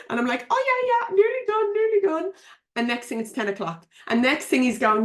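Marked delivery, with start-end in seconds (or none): 0.5-2.3 much higher, absent-minded sing-song; wife humouring husband from the computer; distracted brush-off. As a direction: fob him off without looking up.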